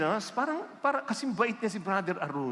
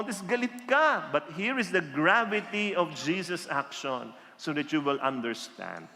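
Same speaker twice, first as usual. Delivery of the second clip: normal talking voice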